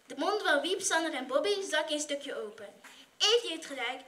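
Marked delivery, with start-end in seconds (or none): none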